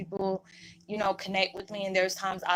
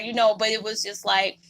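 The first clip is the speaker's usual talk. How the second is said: ordinary talk, nothing marked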